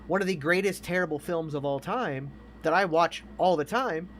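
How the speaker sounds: usual speaking voice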